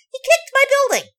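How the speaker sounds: high, loud outrage on "my"; can't believe he kicked MY building; mock-indignant squeal; incredulous. Real, not acted. Indignant disbelief.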